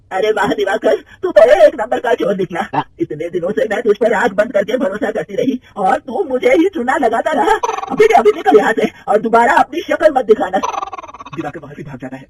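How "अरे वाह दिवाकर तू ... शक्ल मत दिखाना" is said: loud, high, slow tirade; mother-in-law furiously throwing the manager out. Acted. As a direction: throw the cheat out. Angry mother-in-law throws him out.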